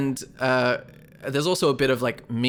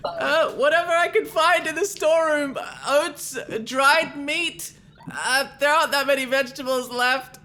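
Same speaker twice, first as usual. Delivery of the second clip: high voice calling from far off; calling from afar; 14 semitones higher than usual. Acted. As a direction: shout it back from a distance. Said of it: "Greg from a distance now says".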